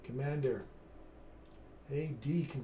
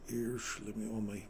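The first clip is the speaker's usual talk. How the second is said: ordinary talk, nothing marked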